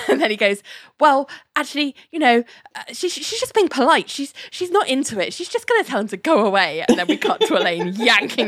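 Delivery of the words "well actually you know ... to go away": flustered excuse-making. Retold or imitated. Larry making excuses for Elaine.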